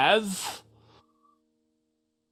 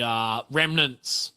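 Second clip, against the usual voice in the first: ordinary talk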